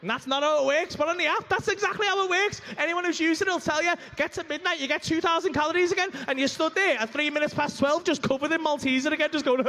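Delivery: high, loud ranting; excited rant. Real, not acted. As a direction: rant it, rising up. Ranting about the app.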